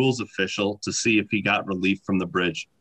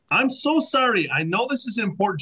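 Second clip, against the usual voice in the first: put-on Canadian accent; 8 semitones higher than usual. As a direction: imitate an apologetic Canadian woman, pitched up. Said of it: "very stereotypical Canadian accent".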